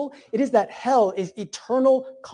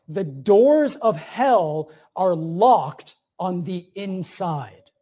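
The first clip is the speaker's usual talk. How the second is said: slow emphatic quote; much slower than usual. Acted. Quoting C S Lewis, slowed.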